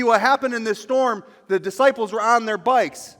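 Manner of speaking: loud, high fervent preaching; preaching at full force. Acted. Pastor to congregation, fervent loud preaching. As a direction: preach it out with fire.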